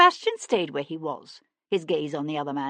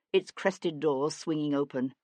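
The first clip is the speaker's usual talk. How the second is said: usual speaking voice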